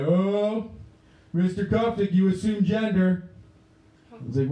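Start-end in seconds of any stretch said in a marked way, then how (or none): 0.0-3.3 put-on high-pitched, drawn-out kid callout; accusing student. Student calling out teacher from back of room, high accusing singsong. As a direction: pitch it way up, scandalized. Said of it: "this kid from the back of the room".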